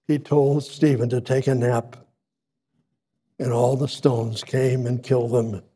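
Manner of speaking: ordinary talk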